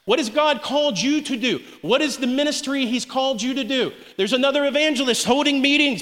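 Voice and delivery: ordinary talk, nothing marked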